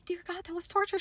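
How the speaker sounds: soft, high, drained gasp of words; small, worn-out complaint; mock exhausted relief. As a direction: let it out weakly after an ordeal. Pulled out of the scanner, exhausted.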